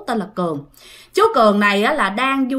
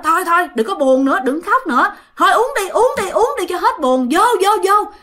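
rowdy urging; 7 semitones higher than usual. Retold or imitated. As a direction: egg him on like a drinking buddy at the table. Drinking buddies urge him to drink. (giục uống rôm rả, cao giọng và to)

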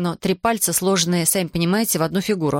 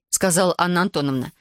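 ordinary delivery, nothing marked

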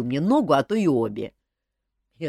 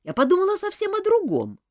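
put-on higher young woman explaining earnestly; young woman Olga; 6 dB louder than usual. A narrator's reading. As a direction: voice the girl, higher and sincere. Olga clarifying.

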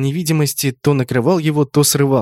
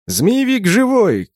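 high, drawn-out call; slow, raised-pitch shout; shouted alert; 9 semitones higher, slower than usual. A narrator's reading. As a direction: call the news out to comrades. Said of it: "крикнул я".

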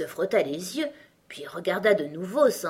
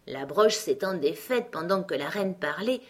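ordinary delivery

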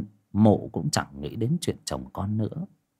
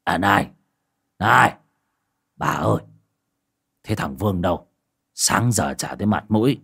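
put-on old man calling after his wife; lightly voiced old man calling out; old husband calling after his wife as she leaves; calling after her; 3 semitones lower than usual. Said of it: "Lão Quyền còn gọi với".

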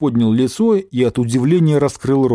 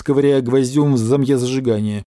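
plain reading voice

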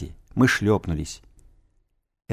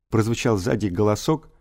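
ordinary delivery, nothing marked

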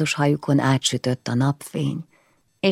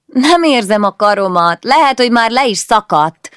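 mock complaint; 8 semitones higher, 9 dB louder than usual. Teen complains under pinning brother.

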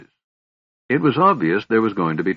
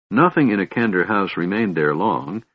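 usual speaking voice

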